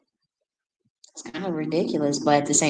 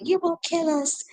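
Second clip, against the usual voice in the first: mild mock squeak, higher; imitating the girl; 12 semitones higher than usual. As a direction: quote her in a higher kid voice. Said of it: "remember the last time she said".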